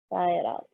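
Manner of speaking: long breathy sigh on the words; dramatic sigh. Shown as a demonstration. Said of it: "sigh it out breaths".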